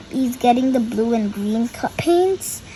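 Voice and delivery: ordinary delivery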